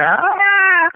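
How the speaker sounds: high, drawn-out squeal of greeting; loud, high mock scream of delight; excited greeting scream. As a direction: shriek like you just spotted an old friend. Friends reacting on seeing each other.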